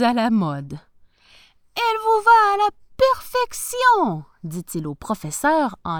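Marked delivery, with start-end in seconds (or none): 1.8-4.2 high-pitched, loud sales flattery; delighted shopkeeper, pitched way up; gushing tailor. A narrator's reading. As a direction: gush over the customer in a high voice. Tailor flatters a hopeful customer.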